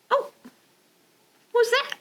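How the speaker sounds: high wondering question; curious surprise. Acted. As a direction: gasp in curiosity. Reader wonders at picture.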